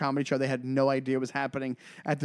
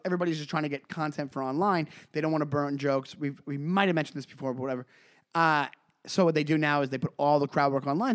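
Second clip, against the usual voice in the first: normal talking voice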